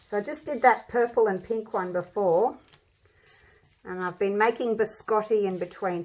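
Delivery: ordinary talk, nothing marked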